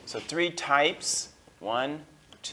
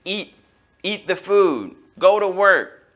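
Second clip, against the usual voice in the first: loud, higher commanding voice; giving orders; 7 semitones higher, 9 dB louder than usual. Shown as a demonstration. Teacher acting out commands to students. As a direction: bark the orders like a boss. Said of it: "when you give an order and you say".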